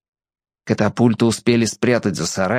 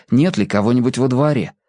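usual speaking voice

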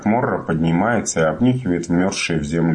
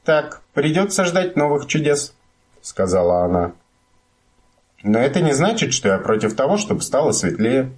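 character voice; 7 semitones higher than usual. A narrator's reading. Little My speaks.

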